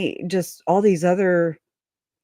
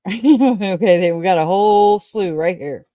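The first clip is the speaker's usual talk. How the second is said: laughing; 3 semitones higher, 4 dB louder than usual. Real, not acted. Laughing at list.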